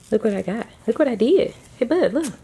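light up and coax the little one to look. Real, not acted. Mother shows toddler new toys.

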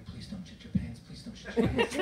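hushed pleading whisper; desperate little whisper; whispered prayer. Retold or imitated. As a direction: pray under your breath. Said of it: "always just praying".

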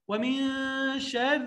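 slow, drawn-out tajweed chant; teacher reciting verse slowly; Quranic recitation. Shown as a demonstration. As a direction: recite it long and measured. Recitation demo.

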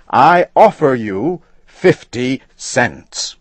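angry insistence. A narrator's reading. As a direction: lay out the sum like to a child. Angry customer insists on price.

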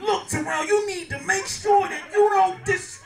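loud, high-pitched teacher's scolding; mock disciplining. Teacher disciplines Terrell in front of a colleague, mock scolding. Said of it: "he tried to discipline Terrell".

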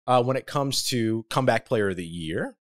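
usual speaking voice

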